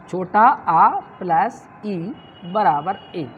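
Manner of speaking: slow, each term spelled out; slow dictation. Shown as a demonstration. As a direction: dictate the sum slowly. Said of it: "ऐसा भी कर सकते हैं". (धीरे-धीरे लिखवाते हुए)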